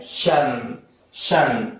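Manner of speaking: "शन" low and falling, on its own; sound demo. Shown as a demonstration. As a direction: model "शन" as one syllable. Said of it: "क्या है". ("शन" की साफ़ ध्वनि)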